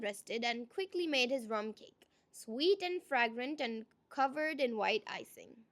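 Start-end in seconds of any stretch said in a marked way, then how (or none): none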